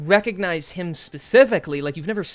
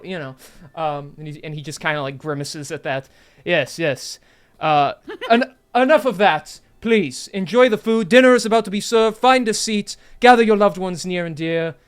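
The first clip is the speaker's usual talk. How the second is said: grand lordly host voice; noble host speech; 2 semitones higher, 8 dB louder than usual. Acted. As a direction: address the crowd like a lord. GM voicing lord's welcome speech.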